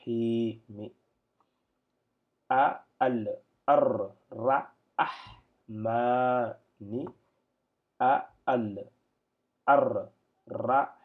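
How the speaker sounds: "arra" with a held doubled R, syllables stretched very slowly and loud; slow loud recitation drill; drawn-out Arabic recitation. Shown as a demonstration. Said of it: "la lettre doublée s'entend plus quand on lit en attaché".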